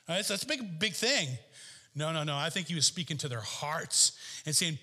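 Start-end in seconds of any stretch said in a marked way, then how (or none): none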